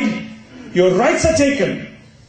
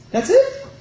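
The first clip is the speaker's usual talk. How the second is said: incredulous high question. Acted.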